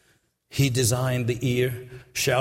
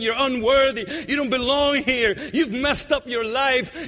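higher, raised accusing voices; accusing voices screaming; 10 semitones higher than usual. Retold or imitated. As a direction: hurl the accusations at her. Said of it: "All those voices screaming out to her".

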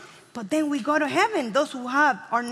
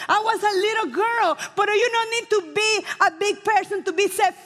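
preaching at full force; 6 semitones higher than usual. Acted. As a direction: preach it like a revival climax. Testimony rises to preaching.

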